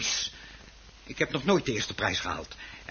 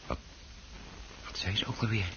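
usual speaking voice

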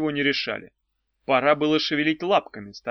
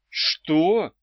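stunned; 4 semitones higher, 5 dB louder than usual. A narrator's reading. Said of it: "ошеломлённо переспросила Ирка".

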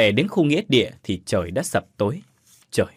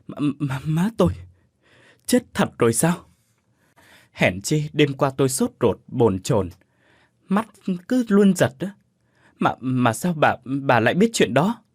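faltering, stumbling stammer, a touch higher; 3 semitones higher than usual. A narrator's reading. Son asking if his mother is truly dead, stammering. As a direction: stammer out the words, shaken. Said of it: "lắp bắp".

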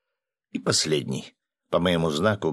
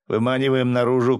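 higher, mildly urgent call; contained shout; 5 semitones higher, 4 dB louder than usual. A narrator's reading. Narrator urging the fighters out of the trailer, contained shout. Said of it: "крикнул я".

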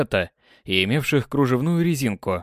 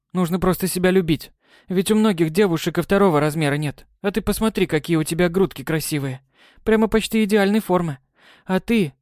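put-on encouraging girl; 6 semitones higher than usual. Май comforts Навахо.

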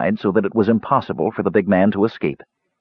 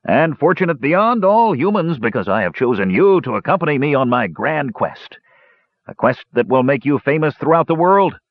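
much higher, swaggering dwarf voice; Dugan's grand boast; 6 semitones higher than usual. A narrator's reading. Dwarf boasting of his grand quest to prisoners. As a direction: sell the quest like a showman. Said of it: "cried Dugan Redhammer lustily".

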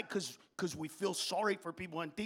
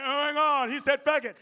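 mock offended crowd; 6 semitones higher, 6 dB louder than usual. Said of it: "you're like".

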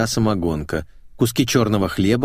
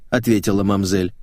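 ordinary delivery, nothing marked

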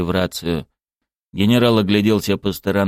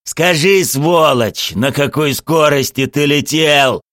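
louder, raised tearful-rage demand; angry roar; 7 semitones higher, 5 dB louder, slower than usual. A narrator's reading. Murtuz demands the driver's speed, roaring angrily. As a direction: roar the accusation, pitched high. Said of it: "заревел Муртуз".

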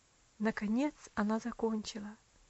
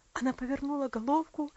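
ordinary delivery, nothing marked

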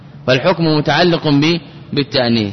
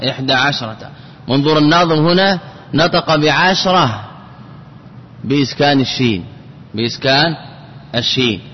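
usual speaking voice

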